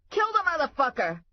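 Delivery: high loud panicked yell; shouting. Acted. Cop yelling at the snake, high panicked shout. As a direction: scream the order in a panic. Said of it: "they both started shouting".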